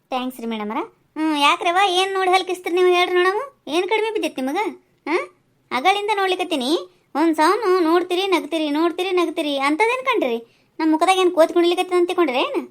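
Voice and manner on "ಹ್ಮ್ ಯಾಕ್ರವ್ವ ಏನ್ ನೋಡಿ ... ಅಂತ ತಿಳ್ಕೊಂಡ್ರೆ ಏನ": louder, higher scolding village auntie; angry confrontation. Acted. Village auntie scolding girls who laughed at her, angry and loud. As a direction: tell them off for laughing at her.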